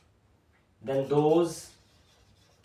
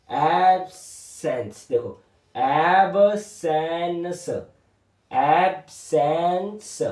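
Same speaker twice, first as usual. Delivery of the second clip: 1 slow drawn-out word; much slower than usual. Shown as a demonstration. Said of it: "teacher drawing and saying word".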